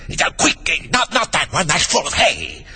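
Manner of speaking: high, hurried half-whisper; frantic king. A narrator's reading. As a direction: hurry him in a panic.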